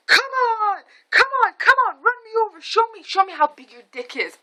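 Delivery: very high, mock-hollered dare; taunting yell. Mom dares driver to run her over, high taunting yell. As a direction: holler it high, then ease down slightly.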